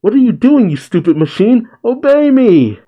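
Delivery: high, loud outraged yelling; indignant shriek of command, performed; acted angry shout. A narrator's reading. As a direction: shout it high at a disobedient machine. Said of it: "As she shouts".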